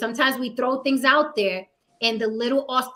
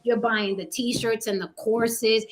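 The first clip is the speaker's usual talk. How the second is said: ordinary talk, nothing marked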